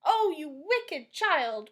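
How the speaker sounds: slow, loud scolding cry; wicked-child rebuke, loud and slow; angry witch cry. A narrator's reading. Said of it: "cried the witch".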